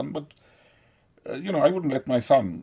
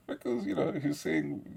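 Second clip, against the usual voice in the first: normal talking voice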